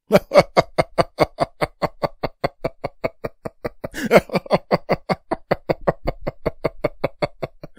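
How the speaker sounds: very long, high villain laugh; maniacal cackle. Acted. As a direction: cackle gleefully at their misfortune. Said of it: "Why are you laughing?".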